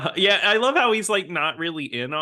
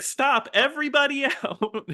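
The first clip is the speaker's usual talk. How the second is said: much higher, laughing shouted order; mock command; 12 semitones higher than usual. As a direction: bark it at the brawl through a laugh. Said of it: "Anthony Stark is just like".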